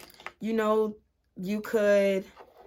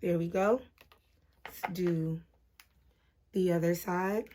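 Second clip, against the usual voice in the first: hushed, breath held while concentrating; quiet, barely breathing; holding breath; 2 semitones lower, 6 dB quieter than usual. Real, not acted. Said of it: "I hold my breath and get quiet".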